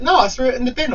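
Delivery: very high put-on denial; mocking house sitter. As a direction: mimic her protest in a high voice.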